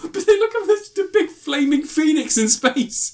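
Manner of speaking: very high, laughing protest; incredulous laughter. Real, not acted. Reviewer insisting to co-host about the sky, laughing incredulously. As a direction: push the words out through giggles.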